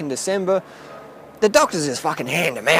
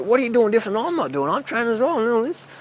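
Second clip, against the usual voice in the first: higher-pitched nagging questions, re-enacted; retold pestering; 6 semitones higher than usual. Trainee pestering the ripped instructor about his secret, higher-pitched retold nagging. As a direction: imitate your younger self badgering someone, pitch climbing. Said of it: "I was just harassing him all day".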